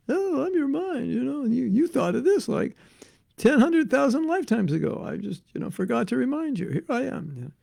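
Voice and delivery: high, coy voice of the mind; the mind answering. Retold or imitated. The mind reminding its owner of old wishes, coy and high. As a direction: play the sly mind, lightly high. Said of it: "the mind answers".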